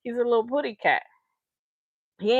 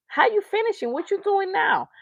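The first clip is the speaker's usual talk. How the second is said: higher, pointed question; higher, daring them to answer; challenging callout; 10 semitones higher than usual. Real, not acted. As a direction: demand an answer. Calling out fallen artists.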